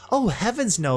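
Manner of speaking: a hint of a sweet-voiced sarcastic unicorn; Velvet's mock dismay. A narrator's reading. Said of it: "Velvet chimed in".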